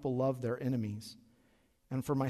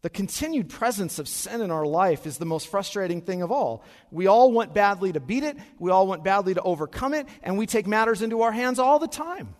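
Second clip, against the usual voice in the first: louder and higher, driving the point home; preaching at full force; 5 semitones higher, 8 dB louder than usual. Acted. Pastor rousing the congregation, loud preaching. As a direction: preach it out with rising fire.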